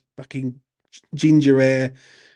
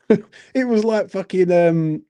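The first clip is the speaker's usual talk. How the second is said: higher, slowed, laughing through the words; real amused laugh; 6 semitones higher, slower than usual. Real, not acted. Man recalling the farce at Ipswich, laughing. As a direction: let the laugh push the pitch up.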